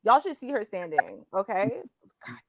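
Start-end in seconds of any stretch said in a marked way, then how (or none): 2.3-2.4 breathless whisper of admiration; whispered awe. Real, not acted. Admiring her co-host in a hushed whisper.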